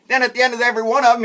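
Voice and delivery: ordinary delivery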